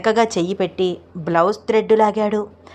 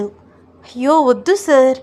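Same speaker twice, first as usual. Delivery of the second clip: high, loud startled cry of a shy bride; flustered shriek, half playful; startled protest; 5 semitones higher, 5 dB louder, slower than usual. Acted. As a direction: yelp it as he drops you on the bed. Roopa thrown on bed, protests.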